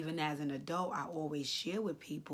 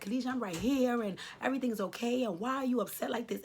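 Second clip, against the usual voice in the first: higher, louder, quicker mock comforting mother; imitating mom; 7 semitones higher, 6 dB louder, faster than usual. Retelling mom consoling her after nightmares, light imitation. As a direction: voice mom soothing her crying daughter, a bit fast. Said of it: "she would always say to me".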